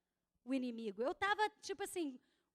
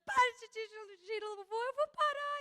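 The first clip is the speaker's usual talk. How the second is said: rising into a higher, louder tearful plea; retold crying prayer; 4 semitones higher, 3 dB louder than usual. Pastor surrendering to God in prayer, crying and rising higher. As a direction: let the voice climb and break as she gives in. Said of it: "Aí eu comecei a chorar".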